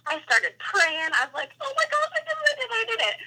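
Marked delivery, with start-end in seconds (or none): 1.6-3.2 very high, squeaky disbelief; high-pitched, emotional chanting; retold tearful elation. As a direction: re-enact an overwhelmed happy squeal. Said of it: "I just started crying".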